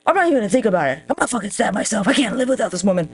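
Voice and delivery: ordinary talk, nothing marked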